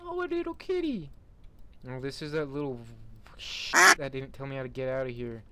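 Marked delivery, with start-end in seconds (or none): 0.1-1.1 cutesy cooing. Real, not acted. Gamer spots a little kitty, cutesy cooing. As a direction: coo in a tiny high voice. Coos over a little kitten.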